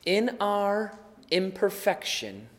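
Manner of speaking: hushed, reverent closing prayer. Real, not acted. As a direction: pray humbly, with long pauses between phrases.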